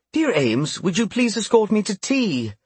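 high, sweet calling voice; a queen calling fondly across the room. Acted. Queen calls over to Ames.

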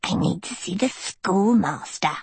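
mocking mimicry of a prim demand; mimicking. A narrator's reading. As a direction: parrot the demand derisively. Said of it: "the albino mimicked".

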